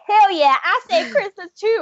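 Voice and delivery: high-pitched cocky reindeer boast; acted excited bragging; boastful Rudolph. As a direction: crow it like an eager show-off.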